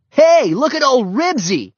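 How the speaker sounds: high, loud, drawn-out call; boy calling out. A narrator's reading. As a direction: holler to the onlookers. Boy calls out over dog fight.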